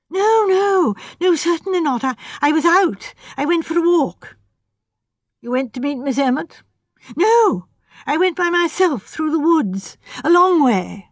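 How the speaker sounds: flustered, insisting he was alone; nervous young architect. A narrator's reading.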